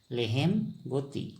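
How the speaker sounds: लेहेम गोती said slowly, each syllable clear; slow clear pronunciation. Shown as a demonstration. Teacher to viewers, repeating लेहेम गोती slowly. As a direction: spell out लेहेम गोती gently for learners. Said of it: "असमिया में बोलेंगे लेहेम गोती".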